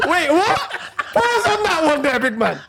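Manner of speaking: high-pitched, laughing interruption; helpless laughter in a high voice; real amused disbelief. Real, not acted. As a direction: object while cracking up. Cracking up at friend's phrase.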